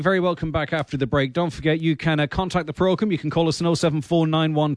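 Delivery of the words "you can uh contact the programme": ordinary delivery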